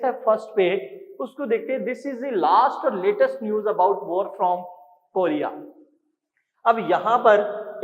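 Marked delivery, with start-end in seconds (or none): 1.8-5.5 very slow, word by word reading; slow dictation. Shown as a demonstration. Teacher reading the sentence to students, slow dictation. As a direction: spell it out slowly for the class.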